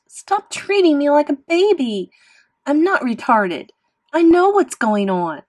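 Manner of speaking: high, loud angry outburst; shrieking. A narrator's reading. Boy refusing to be babied, shrieking. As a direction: shout each protest furiously. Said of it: "I shrieked".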